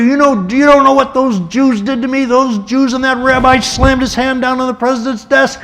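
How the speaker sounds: high, loud, furious outburst at Eddie; Truman's furious tirade. Retold or imitated. Truman rages at his old friend Eddie, hammed-up furious yelling. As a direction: explode in outrage at the request. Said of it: "I wish you would reconsider".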